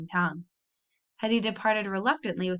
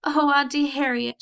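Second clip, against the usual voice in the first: high, loud wailing plea; tearful cry; 6 semitones higher, 5 dB louder than usual. A narrator's reading. Annabel cries to her aunt for help. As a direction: cry out for comfort. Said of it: "she cried".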